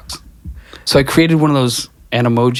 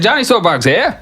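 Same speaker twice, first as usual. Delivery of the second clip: raised brash New York character voice; Johnny Soapbox intro; 8 semitones higher than usual. Acted. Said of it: "But it starts out".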